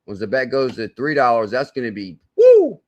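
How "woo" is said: a sudden high, loud whoop; excited woo. Real, not acted. Hyping viewers about the coin price, excited high whoop. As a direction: whoop it out like you just hit the jackpot.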